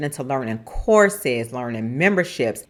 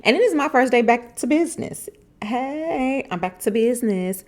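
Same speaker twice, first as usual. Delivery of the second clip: bright sing-song return to work; excited; 8 semitones higher than usual. Real, not acted. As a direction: announce it with a big lift. First day back to work.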